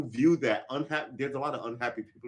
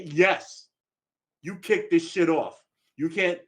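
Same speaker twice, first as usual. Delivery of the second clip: heated retort; 6 semitones higher, 6 dB louder, slower than usual. Retold or imitated. Continuing the street quarrel.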